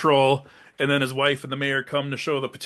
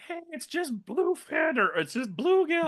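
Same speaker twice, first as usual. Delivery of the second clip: high mock bystander; 14 semitones higher, 4 dB quieter than usual. Bystander voiced correcting him.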